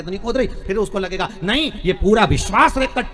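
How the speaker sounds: loud, swelling preacher's declaration; fervent preaching. Acted. Preacher promising Mahadev's grace to the faithful, in a loud, swelling proclamation. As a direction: proclaim it to the whole hall.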